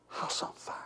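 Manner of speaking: breathy hushed repeat; whisper. Shown as a demonstration. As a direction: whisper it low and close.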